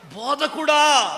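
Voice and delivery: "బోధకుడా" shouted high and slow; "బోధకుడా" shouted slowly and high in rapture; ecstatic shout. Retold or imitated. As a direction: cry "బోధకుడా" out slowly with full joy. Mary crying out to Jesus.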